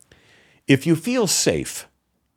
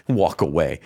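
scoffing laugh; 9 semitones lower than usual. Real, not acted.

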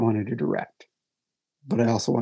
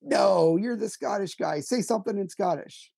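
higher, lightly voiced eager fan; voicing a fan; 8 semitones higher than usual. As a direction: demand it like a pushy fan. Audience member quizzing him.

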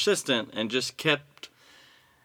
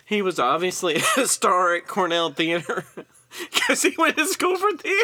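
high voice breaking into giggles; fighting laughter; 6 semitones higher, 4 dB louder than usual. Real, not acted. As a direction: try to explain while losing it to laughter. Cannot continue for laughing.

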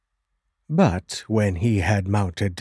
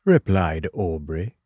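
plain reading voice

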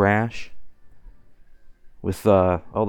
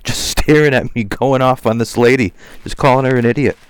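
louder, agitated telling; heated retelling; 4 semitones higher, 6 dB louder than usual. Real, not acted. Vlogger heatedly recounting the street scene, loud. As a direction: push it out, still worked up.

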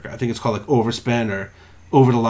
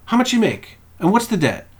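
radio host voice; 9 semitones higher than usual. Retold or imitated. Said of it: "He goes".

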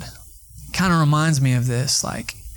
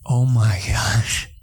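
stunned; 6 semitones lower, much slower than usual. Retold or imitated. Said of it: "I was like".

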